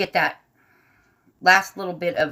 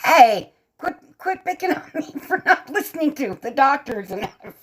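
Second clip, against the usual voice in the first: higher, laughing complaint; playful mock protest; 9 semitones higher than usual. Real, not acted. Streamer to teasing viewers about doctors, playfully protesting.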